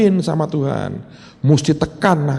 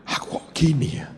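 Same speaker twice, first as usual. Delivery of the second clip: hushed, ashamed whisper; a breathless confession under the breath; remorseful whisper; 3 semitones lower, 7 dB quieter than usual. Retold or imitated. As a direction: whisper it to yourself in regret. Remorseful thought voiced.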